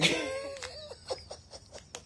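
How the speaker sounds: very high, soft squeal of a laugh; high, soft giggling squeal. Real, not acted. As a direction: let a squeal of laughter slip out. Before the grand finale.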